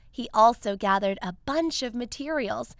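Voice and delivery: ordinary delivery, nothing marked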